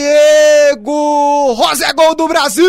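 screaming goal call, high and loud; explosive goal commentary. Real, not acted. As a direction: yell the goal at full voice. Goal scored, commentator erupts.

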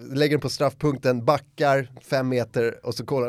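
normal talking voice

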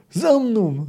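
high, restrained narrated roar; roared command. A narrator's reading. De Ruyter seizes the standard and leads, roaring. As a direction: call the charge, pitched high. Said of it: "ryknął".

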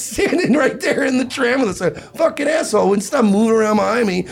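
high-pitched worked-up griping; retold indignant rant. Griping on tram beside the guy, high indignant rant. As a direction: gripe in a high, outraged voice.

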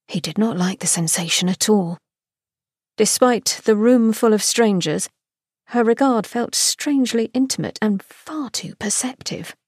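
ordinary delivery

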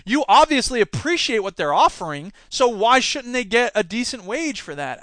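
ordinary delivery

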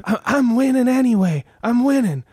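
high, heated boasting; Denzel rant impression. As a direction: crow like a cornered cop. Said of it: "Denzel Washington's character".

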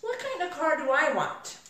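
a pondering "hmm", then wondering aloud; musing. Retold or imitated. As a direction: mull it over, window-shopping. Acting out browsing the dealership.

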